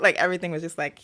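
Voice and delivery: ordinary delivery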